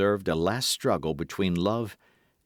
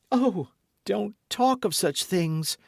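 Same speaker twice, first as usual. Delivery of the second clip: tearful plea; 13 semitones higher, slower than usual. A narrator's reading. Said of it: "cried the procurator's wife, bursting into tears".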